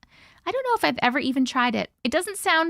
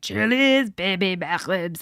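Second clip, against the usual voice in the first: put-on failed Fat Bastard accent; 4 semitones lower, slower than usual. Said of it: "I can't do the accent".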